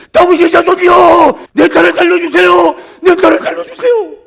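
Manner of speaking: full-volume, high-pitched begging shout; desperate plea. Acted. Mother begging for her daughter's life, desperate shout. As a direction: cry out at the top of your voice, drawing it out. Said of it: "그런 간절함이 있습니까".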